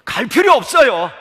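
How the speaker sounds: high, shouted protest; acted insistent refusal. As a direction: shout the refusal back in character. 마르다가 거듭 크게 거부. (크게 소리치며 거절하는 연기)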